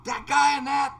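husband urging wife to get in the car, excited. Retold or imitated. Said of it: "I said".

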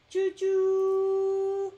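long sung-out choo-choo whistle; drawn-out playful train call. Pretend.

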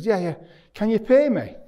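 high, loud, cheeky ask; eager retold request. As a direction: act out jumping straight to asking for money. Acting his blunt request for pay.

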